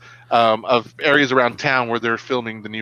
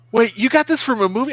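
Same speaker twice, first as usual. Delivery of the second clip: much higher, mock-incredulous question; imitating astonished daughters; 10 semitones higher than usual. Daughters discovering dad's line is from a film, imitated astonishment. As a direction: pitch up like a kid finding out. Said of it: "they're like".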